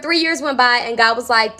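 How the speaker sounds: normal talking voice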